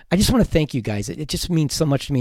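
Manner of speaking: normal talking voice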